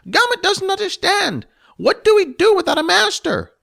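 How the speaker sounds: high, loud goblin voice puzzling over a word; a goblin hearing a strange new word; curious goblin. Acted. Gomet asks Jess what free means.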